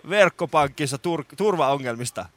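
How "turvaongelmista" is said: normal talking voice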